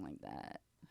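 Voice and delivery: creaky, low fry at phrase ends; vocal fry. Shown as a demonstration. Imitating the Kardashians talking, creaky vocal fry. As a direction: let the voice sink into a creak. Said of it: "vocal fray, when you".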